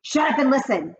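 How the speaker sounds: high sharp snap; snapping witch. Acted. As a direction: cut them off. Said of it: "snapped the Grand High Witch".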